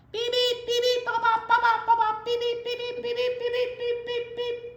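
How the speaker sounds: high monotone robot beeps and bops; rapid staccato beeping, loud then easing off. Pretend. Storyteller being a noisy toy robot, robot beeping.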